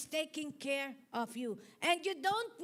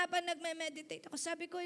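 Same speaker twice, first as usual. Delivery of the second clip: ordinary talk, nothing marked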